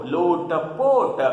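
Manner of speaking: normal talking voice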